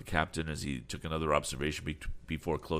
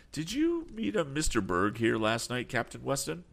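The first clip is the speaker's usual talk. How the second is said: put-on a lightly raised, keen young man's voice; eager young Tom; 10 semitones higher, 3 dB louder than usual. A narrator's reading. Young Tom asks the sea captain about Berg, lightly voiced and eager.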